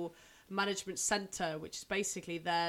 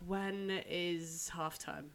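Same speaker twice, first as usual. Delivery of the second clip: softer, drawn-out weary question; weary mock plea; 3 semitones lower, 8 dB quieter, much slower than usual. Student three weeks into college longing for a break, weary. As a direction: act worn out, dragging the words slowly. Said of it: "I am tired".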